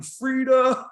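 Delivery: loud, high celebratory holler; imitated yell. Trucker throwing his hands up in pool, imitated yell. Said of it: "starts yelling".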